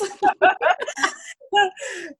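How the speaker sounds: high, sustained laugh; real laugh. Real, not acted. Guest teasing listeners who refuse to journal, high laughter. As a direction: laugh freely at your own tease.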